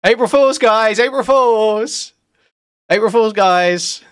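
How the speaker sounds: high, loud laughing reveal; laughing April Fools reveal. Acted. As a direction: burst out with the prank reveal.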